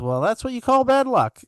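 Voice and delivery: a hint of a cheeky cartoon dad; imitating the Bluey dad. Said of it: "And he goes".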